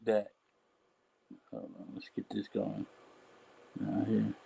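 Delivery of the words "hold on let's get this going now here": whispered aside, far softer; hushed aside to himself. Real, not acted. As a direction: murmur it to yourself while setting up. Muttering aside while setting up.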